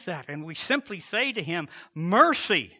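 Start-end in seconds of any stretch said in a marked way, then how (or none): 1.9-2.7 cry for mercy. Acted. The cry to God, acted.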